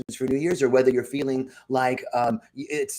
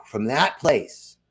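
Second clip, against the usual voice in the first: slow emphasis; 2 semitones lower, much slower than usual. Real, not acted. Repeated phrase slowed for emphasis.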